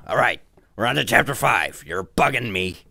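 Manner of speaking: loud, rough cartoon brute voice; Nappa. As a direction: read the chapter title as a rough brute. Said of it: "This is my Nappa voice".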